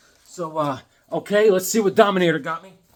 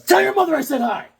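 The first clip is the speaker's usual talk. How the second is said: high, raised-voice send-off; calling out; 11 semitones higher, 5 dB louder than usual. Real, not acted. Host sending regards to a kid's mother, calling out. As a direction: holler a friendly message.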